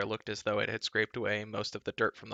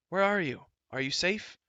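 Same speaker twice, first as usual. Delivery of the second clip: louder, higher worried call; urgent anxious shout; anxious cry; 8 semitones higher, 3 dB louder than usual. A narrator's reading. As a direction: call out for the lost prince. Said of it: "Terran cried".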